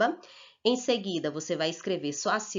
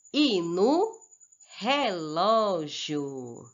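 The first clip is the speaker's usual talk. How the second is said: drawn-out reading, pausing before each missing word; slow fill-in-the-blank reading; much slower than usual. Shown as a demonstration. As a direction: stretch "rolo", "relógio", "repolho", "rolha", "raiz", "folha" after a pause. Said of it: "Vamos voltar no texto para completar".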